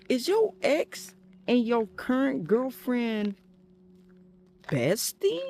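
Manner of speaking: lightly voiced friend; mocking friend. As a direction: linger on besties like the friend did. Friend's question mocked, retold.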